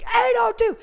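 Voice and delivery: high, loud shouted number; calling a number. Retold or imitated. Guard calling out a ticket number. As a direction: shout it across the room.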